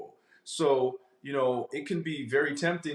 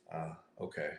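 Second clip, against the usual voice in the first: sheepish and quiet; 8 semitones lower, 10 dB quieter than usual. Retold or imitated. Person accepting God's explanation, quiet and sheepish. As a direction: lower and softer, a little taken aback.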